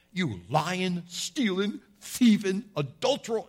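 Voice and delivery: mock accusation, rising in pitch and volume; imitated confrontational list, punched word by word. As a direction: build through each word like a street preacher accusing someone. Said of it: "I think that's so confrontational".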